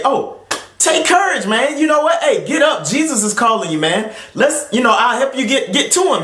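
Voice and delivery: high, overeager mock encouragement; a crowd gushing at the blind man now Jesus calls, high and overeager; fawning crowd. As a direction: ham up the sudden fake friendliness. Fickle crowd now fawning over him.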